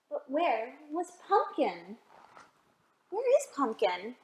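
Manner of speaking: put-on storybook read-aloud for little kids; gentle picture-book narration; reading to children.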